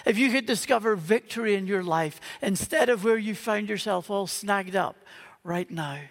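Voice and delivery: ordinary talk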